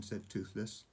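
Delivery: plain reading voice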